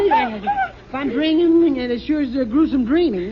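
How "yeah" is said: frightened yelp. Acted. Husband yelps in fear at the noise in the dark.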